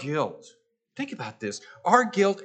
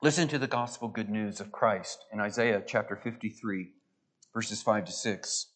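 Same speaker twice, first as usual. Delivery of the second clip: usual speaking voice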